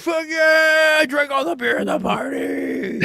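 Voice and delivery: put-on high drunken brag; mock triumphant yell. Drunk guy bragging to the partygoers, high mock yell. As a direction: crow about it like a drunk.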